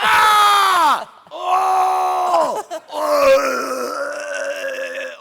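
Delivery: loud high pained scream; battle exertion yell. Shown as a demonstration. Voice actor demonstrating game death screams. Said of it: "standing way back here from the mic going".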